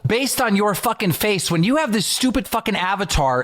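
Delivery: telling off a drunk fan in a bar, fast retold rant; mock rant. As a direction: rattle it off like the bar blow-up.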